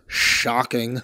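low, soft deadpan word. Retold or imitated. Mark looking at the driver, deadpan and dry. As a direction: drop it flat and dry. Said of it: "he goes".

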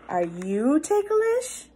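plain reading voice

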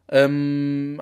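ordinary talk, nothing marked

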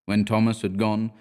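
ordinary delivery, nothing marked

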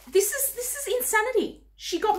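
high-pitched, stunned outburst; disbelieving amazement. Real, not acted. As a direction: exclaim high in happy disbelief. Stunned by generous gifts.

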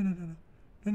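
ordinary talk

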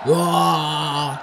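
loud cheering yes; excited cheer. Real, not acted. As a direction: cheer along loudly. Player cheers with the game crowd.

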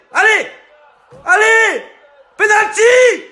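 high shouted claim; frantic fan appeal. Acted. Football fan demanding a penalty, frantic shout. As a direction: yell for the referee's whistle.

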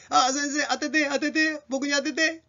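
high-pitched pupil begging the teacher; eager pupil. Acted. Pupil asking the teacher to pick them, high and eager. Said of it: "って言いたいときは".

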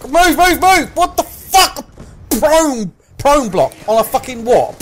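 high, loud panicked commands; screamed urgency and disbelief; frantic yelling. Real, not acted. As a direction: shout urgently, outraged at being blocked. Panic, blocked in game.

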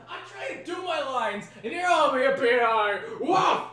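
high exasperated rant at the dog; frustrated high yelling. Real, not acted. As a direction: vent at the yapping dog, half laughing. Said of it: "yelling this loudly".

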